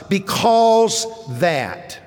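slow and deliberate on "because that"; drawn-out emphasis. Shown as a demonstration. Preacher reads the verse with a slow, stretched stress. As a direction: stretch "because that" slowly, leaning on each word. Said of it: "to say that with the right emphasis".

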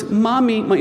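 high, sing-song "Mommy" falling off; toddler calling. Retold or imitated. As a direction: chirp it like a little kid. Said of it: "So it's".

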